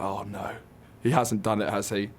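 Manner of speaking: hushed mock dread, trailing off. Daughter voicing her mum guessing what dad did, hushed and worried. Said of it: "my mum's like".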